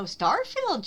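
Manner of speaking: high, loud delighted recognition; light up on the name; excited realization. Real, not acted. Realizes which game.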